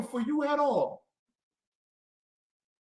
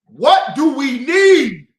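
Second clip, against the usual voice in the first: demanding shout; 3 semitones higher, 10 dB louder, slower than usual. Real, not acted. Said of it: "I can never get the damn answer".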